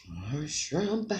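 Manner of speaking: soft breathy whisper to herself. Real, not acted. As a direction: whisper it as a little secret. Whispered aside while eating.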